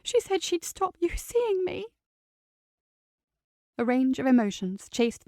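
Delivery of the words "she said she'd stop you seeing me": crying. Acted. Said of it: "She started crying once more".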